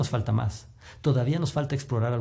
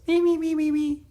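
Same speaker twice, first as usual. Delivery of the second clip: very high squeaky mini voice; mock a tiny cartoonish voice, way up high; exaggerated high-pitched little squeak; silly little voice. Shown as a demonstration. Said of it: "hacer vocecitas".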